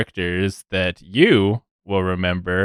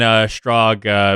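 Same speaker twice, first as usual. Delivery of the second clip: ordinary talk, nothing marked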